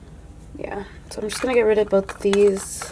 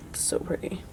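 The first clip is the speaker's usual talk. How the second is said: much softer, unvoiced admiring whisper; awed whisper; 15 dB quieter than usual. Real, not acted. Admiring the gorgeous swatch to viewers, whispered. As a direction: breathe it out in awe.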